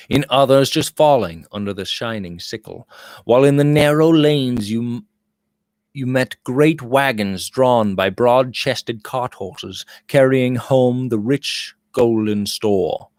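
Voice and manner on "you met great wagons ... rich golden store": ordinary delivery, nothing marked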